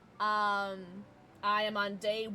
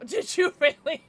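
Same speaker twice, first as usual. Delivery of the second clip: ordinary delivery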